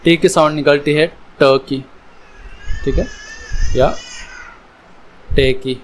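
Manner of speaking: normal talking voice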